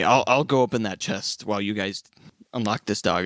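usual speaking voice

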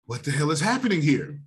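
a sudden high gasp, then a puzzled question; startled gasp. Acted. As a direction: catch your breath, then ask in shock. Man frightened in paradise.

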